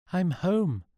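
bright, high happy call home; happy cry. A narrator's reading. Coming home, calls out happily and high. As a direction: Sean announcing he's home, relieved and glad. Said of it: "cried Sean happily".

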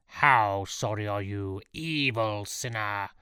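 slow, accusing priest's voice; menacing priest. A narrator's reading. Priest accusing terrified Sean of sin, slow and menacing. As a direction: draw out the accusation slowly. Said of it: "he screamed at his badly nibbled index finger".

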